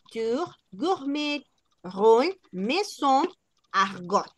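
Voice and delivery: high, drawn-out French word list; teacher modelling French accent, word by word. Shown as a demonstration. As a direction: read each word slowly with French pronunciation. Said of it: "si las leyésemos en francés".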